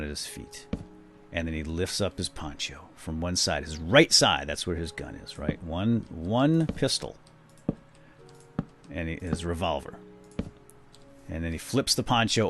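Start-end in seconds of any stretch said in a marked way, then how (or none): none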